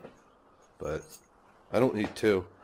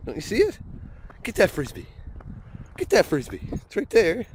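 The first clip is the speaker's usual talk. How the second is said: much higher, playful urging; high coaxing dog voice; 8 semitones higher, 3 dB louder than usual. Real, not acted. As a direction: coax the puppy to fetch.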